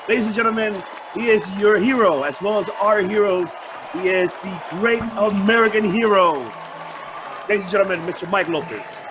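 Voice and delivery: loud announcer hype, lightly done; booming old melodrama narrator. Acted. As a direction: introduce him like a ring announcer. Host introduces the next guest.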